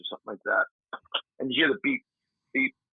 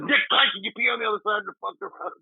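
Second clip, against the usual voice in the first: exasperated plea; 9 semitones higher, faster than usual. Retold or imitated. Buddy begging him to pee elsewhere, high exasperated mock whine. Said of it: "he's like".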